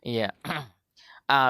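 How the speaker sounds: ordinary delivery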